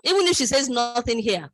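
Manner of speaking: usual speaking voice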